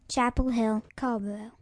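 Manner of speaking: ordinary talk, nothing marked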